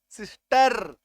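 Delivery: सिस्टर with "uh" before the final r; uh sound. Shown as a demonstration. Teacher pointing out the अ sound in सिस्टर, stretched. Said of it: "अ का sound आ रहा है".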